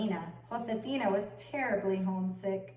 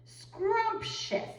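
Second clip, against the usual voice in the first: very high delighted gush; gushing agent; 8 semitones higher, slower than usual. A narrator's reading. Agent gushes over Josefina, high and delighted. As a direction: relish every word.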